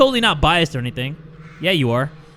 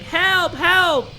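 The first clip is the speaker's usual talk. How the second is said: mock cry for help; 13 semitones higher, 3 dB louder than usual. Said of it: "yell out and be like".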